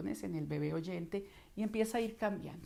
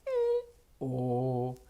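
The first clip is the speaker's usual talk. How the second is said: larynx up and down; 6 semitones lower, 4 dB louder than usual. Shown as a demonstration. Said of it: "¿se da cuenta cómo la laringe sube y baja?".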